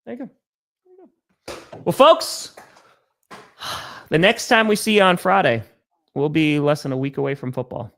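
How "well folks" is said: high, loud show sign-off; announcer voice. Acted. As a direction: call it out to the audience. Host signs off the show.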